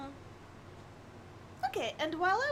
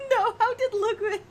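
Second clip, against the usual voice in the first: giggling through the question; laughing; 6 semitones higher, 6 dB louder than usual. Real, not acted. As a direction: laugh while reading it out.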